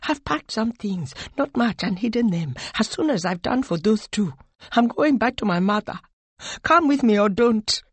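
high, tense, urgent voice; high strained fury, building slightly; distressed fury. A narrator's reading. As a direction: confide it urgently, anger held in. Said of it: "her voice were filled with distressed fury".

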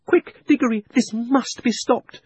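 put-on high-pitched, urgent young voice; urgent girl. A narrator's reading. Polly urging Diggory to stop the witch, urgent. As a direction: urge him in a high voice.

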